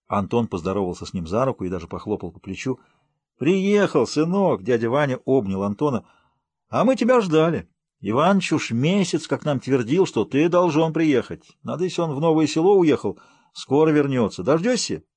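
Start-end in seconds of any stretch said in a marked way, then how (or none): 3.4-4.6 high, hearty village greeting; hearty village uncle. Acted. Uncle Vanya embraces arriving Anton, hearty and high-pitched. 6.7-15.0 high, hearty village greeting; hearty village uncle. Acted. Uncle Vanya embraces arriving Anton, hearty and high-pitched.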